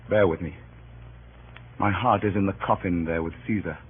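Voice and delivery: low, hushed and heavy with grief; grief-stricken pause. Acted. Antony overcome by grief over Caesar.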